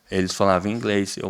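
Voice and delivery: ordinary talk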